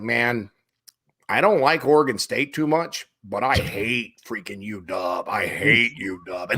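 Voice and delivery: a hint of an Oregon local, dragging out the hate for UW; Oregon accent. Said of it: "talking like an Oregon accent".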